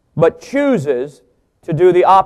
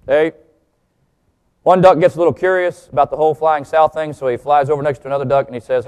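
ordinary delivery

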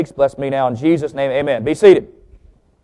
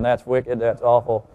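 ordinary talk

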